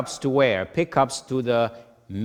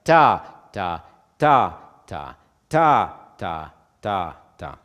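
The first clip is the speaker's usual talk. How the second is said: strong first ta, lighter accent on the third ta; accents on beats one and three; 2 semitones lower, 7 dB louder, slower than usual. Shown as a demonstration. Teacher demonstrating four four with a weaker third-beat accent. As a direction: lean on one, then a smaller push on three. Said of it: "second most accented in a bar for something like".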